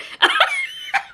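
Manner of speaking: very high burst of laughter; real squeal of a laugh. Real, not acted. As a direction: shriek with laughter. Laughing at her pirate joke.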